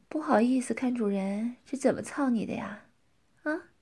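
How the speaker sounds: hushed, breathy teasing whisper; taunting whisper. Acted. Dominant woman whispering a taunt to her partner, teasing whisper.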